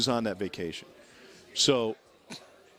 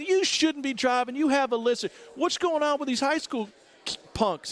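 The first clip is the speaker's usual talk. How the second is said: higher, scolding lawman voice; scolding sheriff; 12 semitones higher than usual. Retold or imitated. Sheriff scolding Johnny about the high school kids. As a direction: scold the drunk driver. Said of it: "the sheriff peers in and goes".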